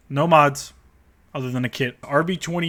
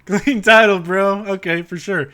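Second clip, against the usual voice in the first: Clean title blurted higher and louder, falling; mocking louder, higher outburst; sarcastic exclaim; 7 semitones higher, 7 dB louder, slower than usual. Real, not acted. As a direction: scoff at the seller's claim. Mocks clean title claim.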